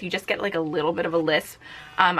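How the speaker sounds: ordinary talk, nothing marked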